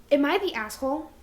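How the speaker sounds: ordinary talk, nothing marked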